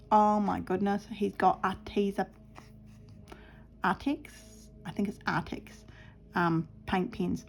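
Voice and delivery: normal talking voice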